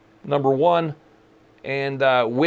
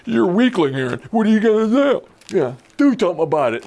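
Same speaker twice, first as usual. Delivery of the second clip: mock sibling goading, pitched up at first; sibling taunt; 3 semitones higher, 3 dB louder, faster than usual. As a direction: imitate a kid daring a sibling to fight. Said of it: "When your sibling would taunt".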